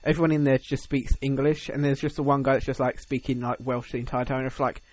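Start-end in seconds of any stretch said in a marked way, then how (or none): none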